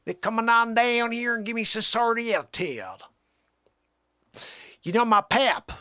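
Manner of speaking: loud, high, hammed-up Southern twang; Southern accent. Country fellow complaining about attitude, Southern accent. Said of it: "never really done a Southern accent before".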